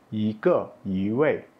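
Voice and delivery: yi rises, ke and wei fall; second-tone yi. Shown as a demonstration. Teacher repeating examples, yi in rising second tone. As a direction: lift yi before each falling word. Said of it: "nada kedua".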